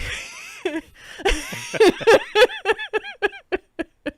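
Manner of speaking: high, loud helpless laugh; squealing belly laugh; loud burst of laughter. Real, not acted. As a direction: crack up hard at your own story. Bursts out laughing mid-story.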